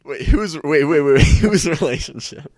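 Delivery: high loud laughing disbelief; surprised. Real, not acted. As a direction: caught off guard, laughing. Shocked interruption.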